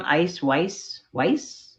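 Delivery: Weiss said like "vice" with a W, rhyming with ice; trying out pronunciation. Shown as a demonstration. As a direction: try out Weiss to rhyme with ice, questioning rise. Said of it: "am I saying it wrong".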